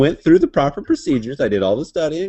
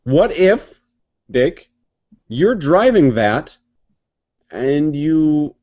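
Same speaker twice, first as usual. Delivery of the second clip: ordinary delivery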